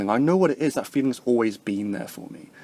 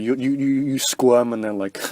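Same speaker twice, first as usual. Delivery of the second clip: ordinary talk, nothing marked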